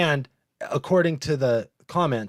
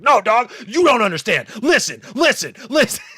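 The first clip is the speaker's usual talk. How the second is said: higher and louder, a mock frantic rush; over-talk him on purpose so he hangs up; hammed-up hyper pleading, climbing on each listen; overanimated pleading; 10 semitones higher, 5 dB louder than usual. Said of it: "get more animated than him".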